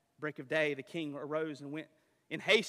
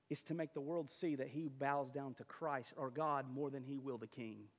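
usual speaking voice

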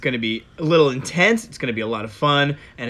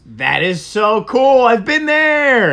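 excited; 9 semitones higher, 7 dB louder, slower than usual. Real, not acted. Excited reaction to camp trip.

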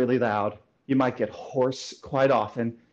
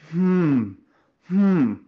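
rising louder hums; hum demo; 4 semitones higher, 4 dB louder than usual. Shown as a demonstration. Voice coach demonstrating a resonance hum. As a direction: hum into the mask. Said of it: "a hum".

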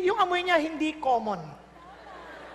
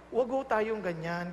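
gentle rebuke; 8 semitones lower, 5 dB quieter, slower than usual. Retold or imitated.

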